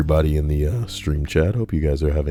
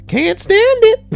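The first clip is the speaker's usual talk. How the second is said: put-on shrill high yell of a delirious old lady; old woman yelling; 6 dB louder than usual. Imitating the delirious patient, a high wailing yell. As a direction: screech it way up high like a confused granny. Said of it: "would repeatedly yell".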